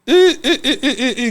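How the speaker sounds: high teasing eh-eh laughter; mocking laugh. Real, not acted. Laughing at Kagame's words.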